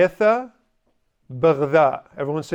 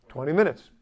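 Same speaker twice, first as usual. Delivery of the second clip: ordinary talk, nothing marked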